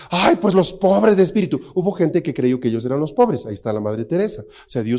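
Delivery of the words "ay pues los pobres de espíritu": mock whiny listener. Mocking self-pitying listeners.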